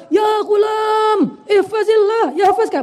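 high, drawn-out pulpit cry; mock preacher's shout. Shown as a demonstration. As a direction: belt it out like a fiery preacher. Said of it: "begitu sampai di kita sebagai penceramah".

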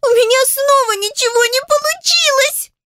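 put-on very high sobbing little-girl voice; high-pitched childish whine; loud tearful wail. A narrator's reading. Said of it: "громко всхлипнув".

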